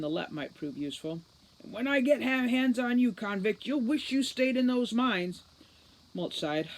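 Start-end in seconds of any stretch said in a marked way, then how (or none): 1.6-5.4 angry threat. Acted. Root threatening Mulch over the earpiece, loud and furious. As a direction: bellow it through the earpiece.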